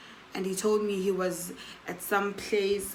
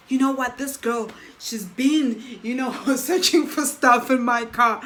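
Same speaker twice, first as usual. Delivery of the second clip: higher, louder accusing voice; angry accusation; 4 semitones higher, 6 dB louder than usual. Retold or imitated. Angry man accusing the girl of searching his car, loud. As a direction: point the finger furiously.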